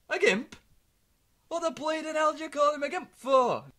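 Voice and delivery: dad demands why they call him a gimp, shouting; mock shout. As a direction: yell it in scandalised disbelief. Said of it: "I goes".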